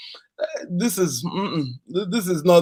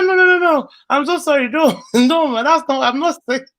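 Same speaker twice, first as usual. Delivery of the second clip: flustered apology; 8 semitones higher, 4 dB louder than usual. Retold or imitated. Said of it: "I said".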